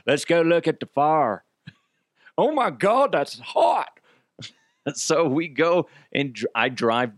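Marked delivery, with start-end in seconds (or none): 0.0-1.4 higher, hammed-up neighbor voice; typical nosy neighbor. Typical neighbor rallying others to the fire, hammed-up gawker voice. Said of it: "like those typical neighbors that are like". 2.4-4.0 high, hammed-up neighbor voice; typical gawking neighbor. Neighbor gawking at the fire, mock amazement. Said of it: "like those typical neighbors that are like".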